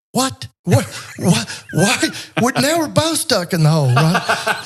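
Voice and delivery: high, laughing what what what; mock disbelief. Stuck man amazed his rescuer jumped in, high laughing disbelief. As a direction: play the stuck man's baffled disbelief, laughing. Retold stunned man in the hole.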